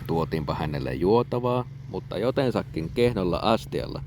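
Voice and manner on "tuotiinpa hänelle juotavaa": ordinary delivery, nothing marked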